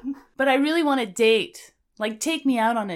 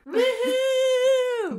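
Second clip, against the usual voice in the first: high excited whoop; cheering woohoo. Real, not acted. Cheering the guest in.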